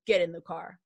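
a hint of a flat, stern father; mock his cold, clipped order; imitating deadpan dad. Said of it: "He deadpans me".

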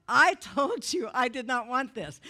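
loud, furious protest to God; angry inner outburst. Retold or imitated. Angry at being healed against her wishes, shouting. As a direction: let the rage burst out. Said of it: "And I was so angry".

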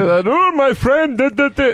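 high, loose mock Egyptian accent; hacky Egyptian accent. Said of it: "what's the hacky Egyptian accent".

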